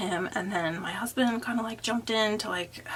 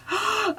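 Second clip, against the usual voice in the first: sharp, high gasp; shocked gasp; 13 semitones higher, 3 dB louder than usual. Retold or imitated. Shocked at being cursed at.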